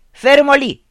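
loud, high order to stop; commanding shout; stern command. A narrator's reading. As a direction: freeze him with a barked order. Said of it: "ingiunse allora il babbo".

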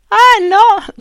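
high, loud teasing laugh-question; giggling mock question; shaking with laughter. A narrator's reading. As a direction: tease him while laughing. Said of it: "scossa dalle risa".

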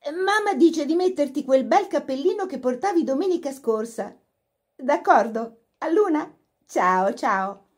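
cheery phone voice. A narrator's reading. Laura back on phone.

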